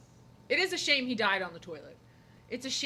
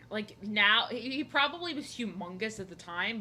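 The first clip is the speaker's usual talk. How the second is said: ordinary talk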